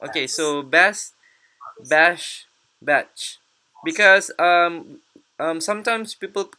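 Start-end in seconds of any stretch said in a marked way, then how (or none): 0.6-3.4 "bass, bash, batch" said separately, each ending distinct; "bass, bash, batch" with short-a vowel and pauses between; contrasting word endings. Shown as a demonstration. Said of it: "This one is bash, right?".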